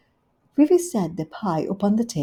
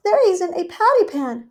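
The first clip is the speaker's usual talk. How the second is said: higher, louder puzzled hostess; Rivy perplexed; 12 semitones higher, 7 dB louder than usual. Acted. Rivy puzzled by Duchess, higher perplexed voice. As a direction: puzzle over it. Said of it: "looking perplexed".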